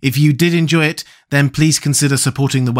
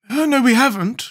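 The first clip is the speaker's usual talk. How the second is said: high-pitched objection; protesting; 12 semitones higher than usual. A narrator's reading. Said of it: "protested the Scarecrow".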